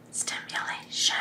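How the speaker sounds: breathy whispered incantation; whispered invocation. Acted. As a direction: breathe the word out as a spell. Guided inhale, invoking.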